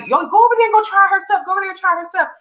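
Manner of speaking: a mock-excited call, pitched high up; excited urging. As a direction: hype up a friend to go try the food. People telling others to try it.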